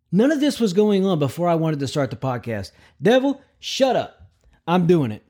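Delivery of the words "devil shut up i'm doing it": mock scolding. Real, not acted. Scolds background noise as devil.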